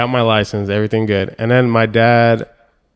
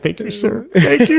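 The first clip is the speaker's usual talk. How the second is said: tearful thanks; 11 semitones higher than usual. Retold or imitated. Thanking the examiner with a tear in his eye, high mock-tearful. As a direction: imitate a choked-up, grateful new driver, high. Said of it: "With a little tear in my eye".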